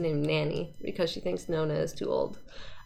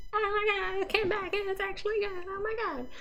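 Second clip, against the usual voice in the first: much higher, excited fan squeal; breathless high-pitched delight; gushing fan; 12 semitones higher, 4 dB quieter than usual. Retold or imitated. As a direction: gush like a thrilled fan who can't believe it. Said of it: "and they're like".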